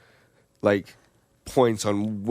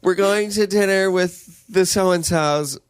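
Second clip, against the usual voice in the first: higher, louder, slow imitation of mom; mother announcing; 9 semitones higher, 5 dB louder, slower than usual. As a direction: sing it out like mom announcing plans. Mother voiced announcing dinner plans.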